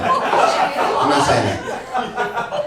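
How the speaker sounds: breathy whispered laugh through a slow denial. Real, not acted. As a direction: whisper it while cracking up.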